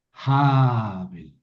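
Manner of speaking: Jabel stressed on the first syllable, JA-bel, with a long falling tone; teacher models the pausal form Jabel stressed on JA; correct pausal stress. Shown as a demonstration. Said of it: "El acento está en la K-metz".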